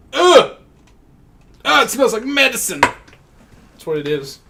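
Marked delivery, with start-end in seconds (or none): 0.1-2.8 high, loud groan of disgust; real grossed-out reaction. Real, not acted. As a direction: recoil at the smell. Disgust at the smell.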